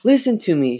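high-pitched desperate cry; shriek. A narrator's reading. Girl begging doubting parents to listen, shrieking. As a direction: shriek to be heard. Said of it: "Chris shrieked".

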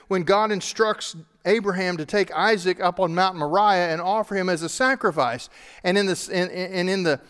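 loud, high, driving sermon voice; preaching at full force. Acted. Pastor to congregation, preaching loud and high. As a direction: preach it out to the whole room.

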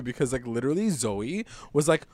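normal talking voice

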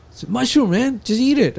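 high, coaxing; friend's voice. Retold or imitated. Friend urging him to eat the mushroom, high coaxing voice. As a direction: push him gently to eat it.

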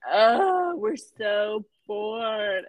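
long drawn-out whine of boredom; hammed-up bored teen complaint; mock bored groan. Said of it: "super bored".

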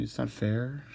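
ordinary talk

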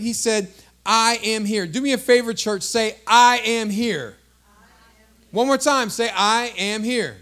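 booming call for the church to repeat; full-force preaching. Real, not acted. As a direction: drive it home to the congregation.

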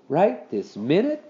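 slow, mournful rising question. A narrator's reading. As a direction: ask it reluctantly, drawing it out. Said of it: "Henry asked mournfully".

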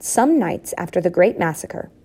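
usual speaking voice

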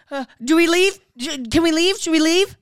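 loud, high pleading questions, reliving the panic; panicked asking. Retold or imitated. Retelling how she begged her friend to leave the theater, loud panicked pleading. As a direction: beg to get out of there, each question rising.

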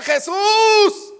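high, loud drawn-out shout; long ringing cry; shouting the name. Shown as a demonstration. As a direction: yell it out with all your might. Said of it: "hay que gritar".

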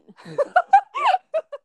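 high, loud burst of laughter. Real, not acted. Podcaster laughs at her own network pick point, loud laugh.

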